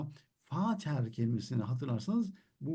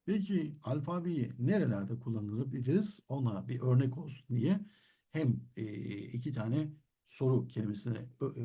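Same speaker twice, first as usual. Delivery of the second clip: normal talking voice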